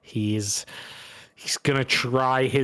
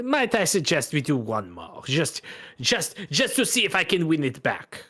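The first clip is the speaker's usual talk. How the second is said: higher, pleading Bretonnian gambler; French-accented gambler; 7 semitones higher than usual. Acted. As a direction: wheedle for one more round, French-accented.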